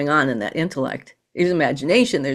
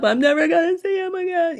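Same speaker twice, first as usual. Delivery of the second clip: high, aching sad inner voice; sad thought; 11 semitones higher than usual. Retold or imitated. Grieving person's inner thought, a touch of sorrow. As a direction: voice the hopeless thought of grief. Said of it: "when you very sad".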